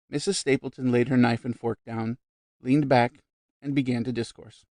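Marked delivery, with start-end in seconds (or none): none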